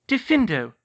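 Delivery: high, loud spell word; incanting a spell. A narrator's reading. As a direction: call the spell out sharply. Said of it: "she incanted".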